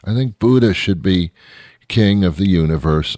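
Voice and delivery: ordinary talk, nothing marked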